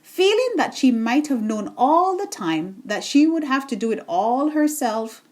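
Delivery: usual speaking voice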